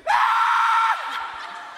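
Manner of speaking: piercing mock child scream; high-pitched scream. Six-year-old trying to out-shout everyone, very high scream. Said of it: "I could scream that high".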